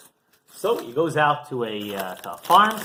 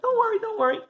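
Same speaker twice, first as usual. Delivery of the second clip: calm reassurance; 8 semitones higher, 6 dB louder than usual. Retold or imitated. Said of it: "the farmer's like".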